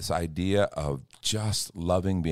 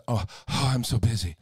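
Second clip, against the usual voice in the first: mock busy sigh; 5 semitones higher than usual. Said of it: "we say it that way".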